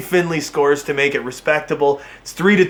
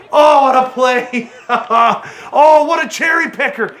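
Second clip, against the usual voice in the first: much higher, laughing shout of disbelief; high, slowed laughing yell; laughing outburst; 8 semitones higher, slower than usual. Real, not acted. Outburst at a breakaway goal.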